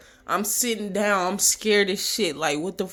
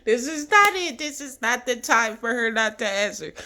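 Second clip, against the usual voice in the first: panicked inner voice; 6 semitones higher than usual. Retold or imitated. Panicked inner voice, mom unreachable.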